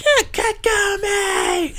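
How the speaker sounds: shrill high mock scream of names. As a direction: scream both names like the dub. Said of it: "the way that she would scream his name".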